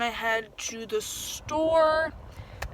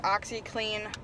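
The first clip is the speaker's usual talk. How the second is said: ordinary delivery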